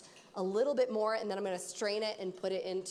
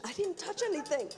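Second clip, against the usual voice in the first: high mock-defensive squeak; imitating defensive denial; 8 semitones higher than usual. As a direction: mimic his startled denial, pitched up. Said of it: "I love how that was your, like, instant reaction".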